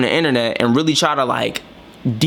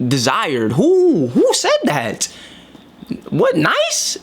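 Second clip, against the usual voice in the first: incredulous indignation; 3 semitones higher than usual. Real, not acted. Speaker questioning who ever prized niceness, incredulous indignation. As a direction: challenge the idea, pitch leaping in disbelief.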